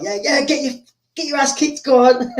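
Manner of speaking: high-pitched mock jeer; jeering haters. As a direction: imitate a crowd wanting him beaten. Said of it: "his haters are like".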